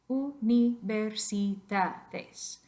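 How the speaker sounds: universidades very slow, u-ni-ver-si-da-des; syllable by syllable. Shown as a demonstration. Teacher dividing universidades for the class, syllable by syllable. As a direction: stretch universidades out, each syllable separate. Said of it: "cómo dividir las sílabas".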